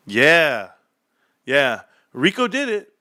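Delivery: ordinary talk, nothing marked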